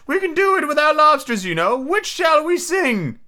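high-pitched, earnest storybook turtle; mock turtle. Mock turtle telling Alice about sea life, earnestly.

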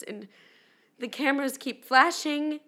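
ordinary talk

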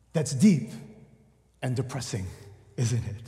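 a quiet, rueful aside; hushed and low. Acted. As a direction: drop low and soft, wry. Commenting on bleak meaning.

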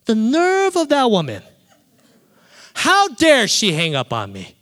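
hammed-up outrage, high and loud; heated indignation. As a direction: act affronted, pitch leaping up on the first phrase. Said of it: "I was so heated".

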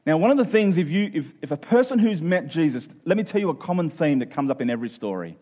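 ordinary talk, nothing marked

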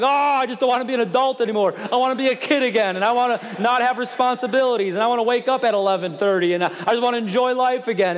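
high, loud, quick mock tantrum; exasperated complaint. Pastor mimicking himself fed up with adulthood, exasperated. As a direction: rattle off a hammed-up grown-up whine.

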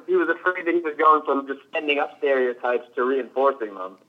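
normal talking voice

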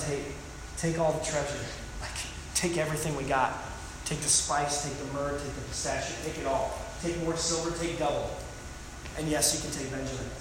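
soft, resigned voicing of Jacob; lightly voiced father giving in; a hint of weary surrender. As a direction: give it all up in a tired near-mutter. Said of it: "There is just a shard".

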